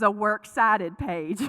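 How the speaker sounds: Southern "works cited", breaking into laughter; Southern accent. Retold or imitated. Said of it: "was the way that it sounded like".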